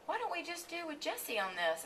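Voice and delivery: breezy producer pitching an idea; light, high imitation of the producer on the phone; imitating the producer. He's like.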